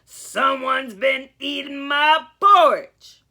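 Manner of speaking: Papa Bear finding his porridge eaten, mock roar. A narrator's reading. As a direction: boom it out like an angry papa bear. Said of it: "roared Papa Bear".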